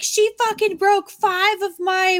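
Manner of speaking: high, loud, drawn-out indignation; mock outrage, stretching the words; grandma's complaint. Grandma complaining about her broken things, indignant.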